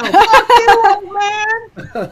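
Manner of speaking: very high, loud drawn-out yell; heckler's scream. Acted. Game master voicing one heckler at the captain, screamed insult. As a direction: scream the insult across the water. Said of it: "You hear one person go".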